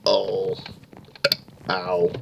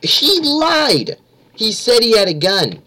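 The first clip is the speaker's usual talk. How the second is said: high outraged cry; 10 semitones higher, 3 dB louder, slower than usual. Real, not acted. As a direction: cry out betrayed. Outraged at killer's lie.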